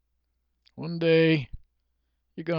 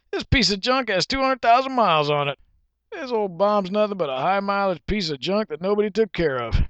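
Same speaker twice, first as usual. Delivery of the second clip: high-pitched acted exasperation; exasperated; 7 semitones higher, 3 dB louder than usual. Shopper condemning an old junk car, high and exasperated. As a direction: rant about it in a raised pitch.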